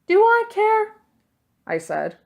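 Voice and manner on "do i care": much higher, louder scoffing question; a defiant young thief scoffing; defiant retort. A narrator's reading. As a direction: toss it back defiantly.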